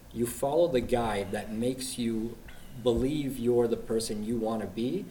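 ordinary talk, nothing marked

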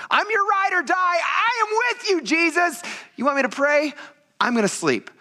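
very high, squeaky mock bravado; hammed-up boasting. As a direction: imitate an over-eager loyal disciple way up high. Mocking Peter's boasting to Jesus.

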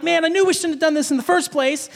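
high, retold panic; freaking out. Retelling himself as a kid whose friend is stuck, freaking out. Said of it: "because I'm freaking out".

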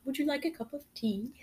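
soft, high sample line; prompt to copy. Shown as a demonstration. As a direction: offer the line softly and high, as a cue. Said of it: "well, do it. Say".

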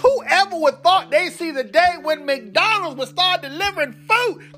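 normal talking voice

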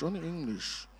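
echoed aside. Acted. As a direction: echo the line as a playful aside. Repeats the phrase about his wife.

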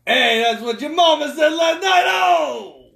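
high, loud New York wiseguy jeer; hammed-up New Yorker shouting a punchline; New York accent. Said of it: "talking with a New York accent".

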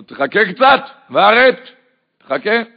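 wait, I said, calling back; father's loud retort. Retold or imitated. Father waving off his kids, loud and high. Father answers impatiently, raised voice.

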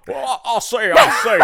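loud, high blustering Southern rooster voice; Foghorn Leghorn. Pretend. Co-host joining a Southern scene, loud cartoon rooster voice. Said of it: "Is that too much foghorn leghorn".

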